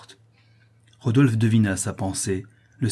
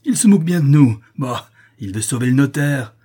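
loud, raised-voice raving; frenzied angry shout; deranged outburst; 4 semitones higher, 8 dB louder than usual. Acted. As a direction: rage wildly while struggling against someone. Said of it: "complètement égaré, en luttant contre Rodolphe".

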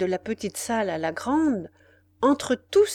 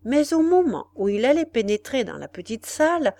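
usual speaking voice

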